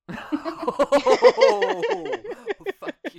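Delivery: high laughing protest; laughing mock outrage. Real, not acted. Laughing at the old jab.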